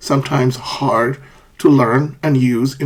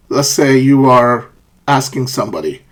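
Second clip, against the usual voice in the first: ordinary talk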